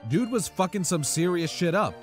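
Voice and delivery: plain reading voice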